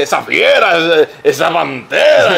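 slow, drawn-out catchphrase imitation; imitated stage bit. Host recalling the comedian's act, slow exaggerated imitation. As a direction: stretch the catchphrase out slowly. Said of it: "cuando tú estabas haciendo que".

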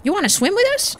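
put-on high-pitched child voice, inviting; inviting little kid. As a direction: play a small kid asking to play. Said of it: "let's talk to the kids".

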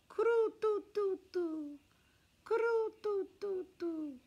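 high, slow snore sound; snoring noise. A narrator's reading. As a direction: make a comic snoring noise. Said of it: "That's him snoring".

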